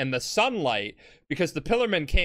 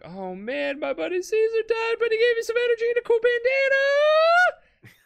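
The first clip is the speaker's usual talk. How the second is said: much higher, hammed-up goofy hero voice; mock Jojo voice. Jojo mourning his buddy, in a mock high goofy voice. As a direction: squeak it out like a cartoon hero.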